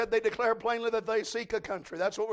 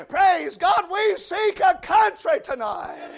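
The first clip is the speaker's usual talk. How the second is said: loud, slow, higher revival preaching; preaching at full force; 9 semitones higher, 15 dB louder, much slower than usual. Acted. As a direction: drive the verse home to the crowd. Preacher shouting the sermon.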